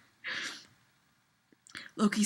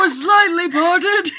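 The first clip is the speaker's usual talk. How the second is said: laughing shout; 5 semitones higher, 10 dB louder, slower than usual. Real, not acted. Laughing reread, cracking up.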